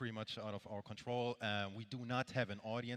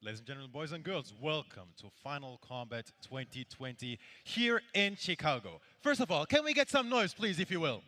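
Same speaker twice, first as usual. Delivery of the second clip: booming ring announcer hype, rising louder; grand entrance announcement; 5 semitones higher, 7 dB louder than usual. Acted. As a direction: build the crowd up like a fight announcer. Host opens tournament, hypes crowd.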